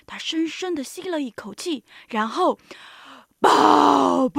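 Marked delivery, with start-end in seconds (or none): 3.4-4.4 loud, long two-blast call; drawn-out "baa-boo" trumpeting; elephant trumpet. Acted. Elephant trumpets after deep breath.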